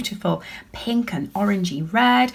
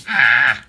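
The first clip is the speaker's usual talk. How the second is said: a lower, louder cartoon scream; mock scream; 7 semitones lower, 6 dB louder than usual. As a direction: let the alien yell in mock fright. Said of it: "looks like it's going".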